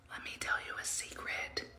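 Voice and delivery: breathy secret whisper. Acted. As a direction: lean in and whisper it. Whispered aside to viewers.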